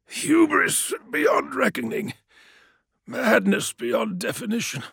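high, slow shouted contempt; furious prisoner, pitched high; raging captive. A narrator's reading. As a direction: spit your scorn at the conqueror. Captive curses.

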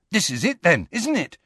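high, eager question, lifting at the end; excited. A narrator's reading. Ron joins Harry at the locked door, excitedly. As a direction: get eager, pitch climbing. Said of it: "said Ron excitedly".

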